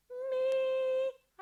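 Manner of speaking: loud high sung-out meow; a long singing cat meow. Shown as a demonstration. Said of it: "Okay, let's try it".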